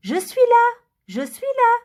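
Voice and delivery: appel aigu et fort venu d'en haut; lointain appel. Acted. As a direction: crie de loin, d'en haut. Maman appelle de loin. (voix lointaine qui appelle, plus aiguë et plus forte)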